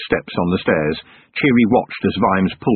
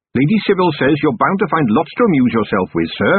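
a bit higher, earnest captain; captain's voice; 6 semitones higher than usual. Acted. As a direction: reassure the commander as the captain. Narrator voices the young captain.

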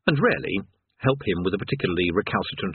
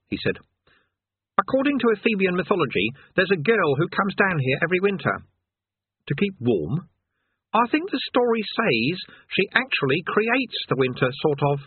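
put-on higher, earnest boy's voice; a know-it-all lad, put off; young Eric; 8 semitones higher than usual. Eric to Rincewind walking away, higher boyish voice.

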